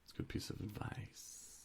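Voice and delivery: whispered aside; softly whispered agreement. Real, not acted. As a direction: breathe it out under your breath. Aside after advice.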